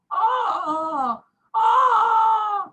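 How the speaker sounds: hammed-up pain groans, building to high, loud yells; mock agony cries rising to a loud, high howl; pained groaning cries. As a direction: groan like a kidney stone is twisting inside. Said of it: "मैं चिल्ला रहा हूँ जोर जोर से".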